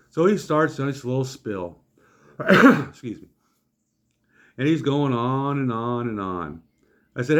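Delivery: usual speaking voice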